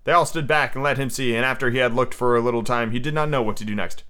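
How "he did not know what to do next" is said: ordinary delivery, nothing marked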